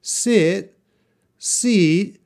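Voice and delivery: short i in "Sit", long ee in "seat"; sit then seat contrasted. Shown as a demonstration. Teacher contrasting the two vowels. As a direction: clip "Sit", stretch "seat". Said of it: "This is not British accent".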